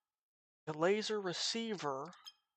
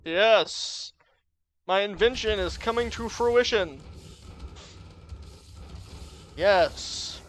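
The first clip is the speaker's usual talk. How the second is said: loud gloating mad scientist; evil genius; 3 semitones higher, 10 dB louder than usual. Pretend. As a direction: crow like a triumphant villain. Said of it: "I'm an evil genius".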